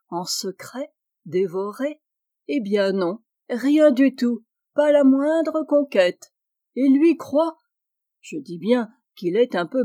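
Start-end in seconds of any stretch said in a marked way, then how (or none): 2.5-7.6 higher, louder crowing; gleeful triumph. Acted. Wife gloating that no lover exists, triumphant and loud. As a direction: crow over the harmless trick.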